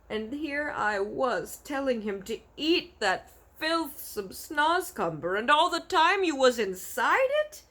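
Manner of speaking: rising high and loud in dismay; distressed cry. Acted. BFG dismayed he nearly fed her to the giant. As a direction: cry out in horror. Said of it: "the B F G cried".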